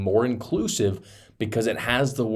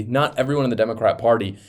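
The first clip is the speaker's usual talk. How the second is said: ordinary delivery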